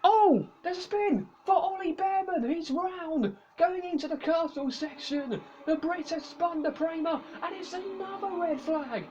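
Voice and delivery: excited dramatic race call; motorsport commentator. Acted. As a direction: make the spin sound dramatic.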